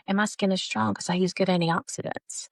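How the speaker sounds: ordinary talk, nothing marked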